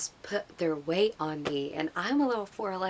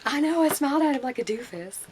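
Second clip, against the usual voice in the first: high, loud imitation of a giddy fan; gushing fangirl; 7 semitones higher, 6 dB louder than usual. Girl in line admitting her goofy smile, gushing. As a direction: squeal in embarrassed delight.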